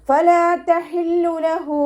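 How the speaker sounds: high, slow chanted verse; recitation demo. Shown as a demonstration. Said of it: "ഹ അക്ഷരത്തിൻ്റെ സൗണ്ടിനെ".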